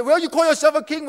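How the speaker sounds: mocking taunt. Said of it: "to mock him and say".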